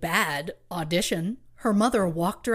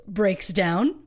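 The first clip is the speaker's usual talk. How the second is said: ordinary talk, nothing marked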